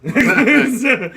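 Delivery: cracking up, loud and high; helpless laughter breaking up the word; laughing. Real, not acted. Can't stop laughing at own joke.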